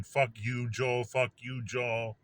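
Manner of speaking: ordinary talk, nothing marked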